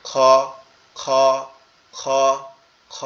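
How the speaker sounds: "খ" from the back of the throat; deep throat kha. Shown as a demonstration. Teacher shows a throaty "খ" to students. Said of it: "এইখান থেকে উচ্চারিত হবে".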